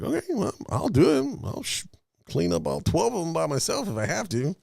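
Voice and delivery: high mock eager volunteer. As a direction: act gung-ho and naive. Self quote, mock bravado.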